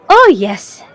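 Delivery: usual speaking voice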